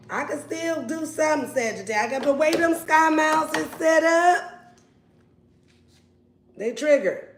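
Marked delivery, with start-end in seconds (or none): 0.1-4.8 pleading ex. Pretend. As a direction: plead with the sky miles. Ex pleading again.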